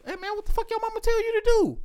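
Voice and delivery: fast, high-pitched telling-off; retold scolding. Granddad getting on a grandkid, high fast scolding.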